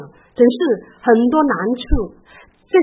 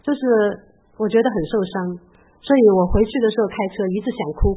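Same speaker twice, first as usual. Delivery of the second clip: usual speaking voice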